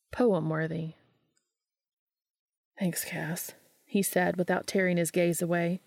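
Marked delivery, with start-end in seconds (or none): 2.8-3.5 hushed, softer whispered thanks. A narrator's reading. Dean thanking Cass on the bridge, whispered. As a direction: whisper it, awed by the view.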